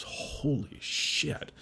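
slow, breathless whispered curse; awed whisper. Retold or imitated. Fire chief seeing the blaze, awed whisper. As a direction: let it slip out under your breath, stunned.

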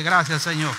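loud, falling proclamation of thanks. Shown as a demonstration. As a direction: call it out for them to repeat. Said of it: "dígale".

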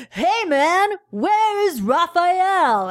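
high, drawn-out mock call; mock naive calling. Turtles blundering into the junkyard setup, mock naive call. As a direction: stretch it out like an oblivious caller. Mocking turtles walking into a trap.

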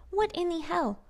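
high, outraged, punched WHAT; high exasperated repeat. Real, not acted. Vlogger to viewers, repeating it in outraged disbelief. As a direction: hit "What" hard, still stunned.

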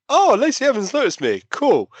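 high-pitched, thrilled squeak of delight; excited fan. Retold or imitated. Fan retelling being noticed by a wrestler, excited. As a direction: gush it high, like a starstruck fan. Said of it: "And I'm like".

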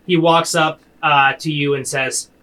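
ordinary talk, nothing marked

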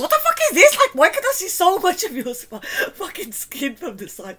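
high, loud squawk of disbelief; incredulous outburst. Real, not acted. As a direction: shriek in disbelief at a friend's outfit. Mocking the holey vest.

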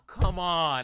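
high, loud, drawn-out groan of protest; mock exasperation. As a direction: imitate a player who just lost everything. Retold dismayed player quitting the game.

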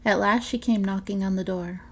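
ordinary delivery, nothing marked

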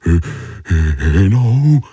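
slow stammer, stuck on the first syllable; scared starfish stammers the word out; stuttering. Acted. Frightened Tony stammers at the coral, slow and stuttering. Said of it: "začal koktat Tony".

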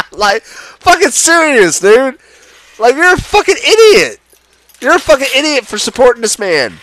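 high-pitched, heated scolding; exasperated outrage. Real, not acted. Ranter berating the president's supporters, exasperated outrage.

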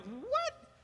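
very high, rising mock disbelief; incredulous what. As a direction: squeak it out as a stunned onlooker. Acts disciples' disbelief at Peter.

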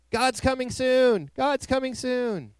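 high, drawn-out street-corner yell; screaming bullhorn preacher. Acted. Bullhorn guy screaming at passers-by in a public space, mock yell. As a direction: stretch it out in a high mock holler. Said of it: "screaming out".

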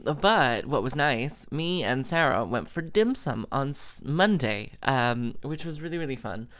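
usual speaking voice

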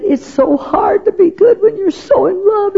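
put-on high, swooning girlish sigh of a voice; lovesick teen daughter. The fifteen-year-old daughter to her mother, a high lovesick swoon.

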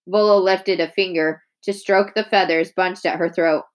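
ordinary delivery, nothing marked

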